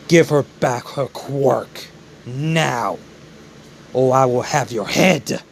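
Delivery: slow, measured ultimatum; menacing threat. Acted. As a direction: lay down the threat slowly and firmly. Acted threat to defeated villain.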